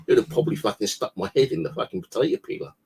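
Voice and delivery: usual speaking voice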